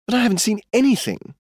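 high, fast, indignant cry; cried out. A narrator's reading. Girl cries out to her cousin in protest, high and fast. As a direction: burst out in protest. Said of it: "Skye cried".

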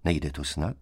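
soft, unruffled query; calm question. A narrator's reading. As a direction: ask it quietly, untroubled. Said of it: "zeptal se K s klidem". (klidně a tiše)

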